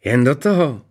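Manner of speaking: high, goading queen; egging on. A narrator's reading. Queen urging the princess to continue, goading. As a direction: goad her on. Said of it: "popichovala Ksenii falešná královna".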